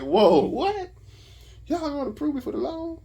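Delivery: ecstatic surprise. Retold or imitated. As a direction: retell it amazed, voice leaping up. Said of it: "I'm ecstatic".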